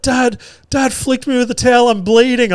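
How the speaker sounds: very high-pitched mock wail of a hurt kid; imitated screaming child. Son tells on dad after a towel flick, mock screaming. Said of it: "He's screaming at the top of his lungs".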